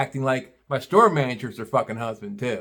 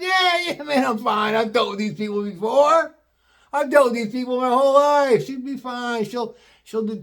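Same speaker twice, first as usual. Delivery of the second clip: high breezy reassurance; 9 semitones higher than usual. Retold or imitated. Voicing himself calming new kid.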